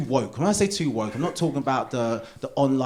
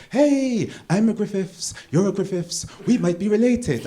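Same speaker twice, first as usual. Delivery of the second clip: put-on higher, lilting Welsh accent; excited Welsh; 5 semitones higher than usual. Welsh stranger greeting a fellow Griffiths, excited Welsh accent. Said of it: "very excited Welsh people come up to me going".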